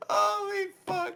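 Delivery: high-pitched laughing; laughing hard. Real, not acted. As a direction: gasp it out through laughter. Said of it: "laughing hard".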